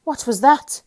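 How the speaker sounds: high, loud alarmed question; frightened shriek. A narrator's reading. Mister Jelly shrieking at a snapping twig. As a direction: shriek at the snap. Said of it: "he shrieked".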